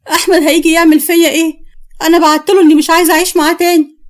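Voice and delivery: high-pitched anxious protest; worked up over what may happen; alarmed. Acted. Panicking woman fears husband's reaction.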